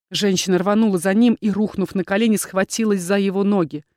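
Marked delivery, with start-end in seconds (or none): none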